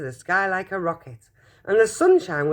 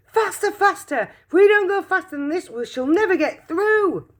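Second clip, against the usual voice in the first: urgent cry; 13 semitones higher, 4 dB louder than usual. Acted. Wonka urging the lift.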